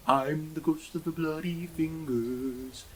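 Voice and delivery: slow, eerie ghostly moan; spooky ghost voice. Acted. Ghost calling from the bathroom to the cyclist, eerie moan. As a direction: draw it out like a haunting.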